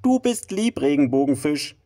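high, sweet happy voice; delighted little fish. Acted. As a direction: say it brightly and high.

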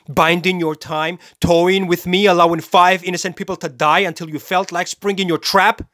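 high, heated challenge; mocking accusation. Killer mocks the detective's supposed plan, heated and accusing. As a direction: press the accusation with rising scorn.

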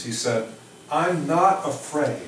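normal talking voice

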